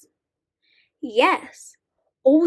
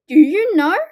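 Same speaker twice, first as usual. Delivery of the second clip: louder repeat; 2 semitones higher, 7 dB louder, faster than usual. Acted. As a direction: call the question out louder, teasing the kids. Repeated question to children.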